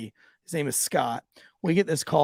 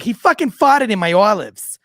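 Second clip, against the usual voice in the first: loud outraged shout; 6 semitones higher, 11 dB louder than usual. Retold or imitated. Cop imitating the furious wife's loud accusation.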